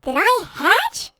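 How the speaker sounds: a tiny seal asking, up high and drawn out; baby seal voice. Acted. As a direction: newborn seal in the egg asking, very high and slow. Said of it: "the little seal coming out of the egg says".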